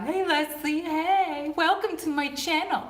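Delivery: high, playful sing-song greeting; talking-to-a-dog voice. Pretend. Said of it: "talking to you like you guys are the dog".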